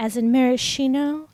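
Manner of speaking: mock coy delivery; with a wink. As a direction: say it as a knowing flirty aside. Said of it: "with, like, a wink kind of".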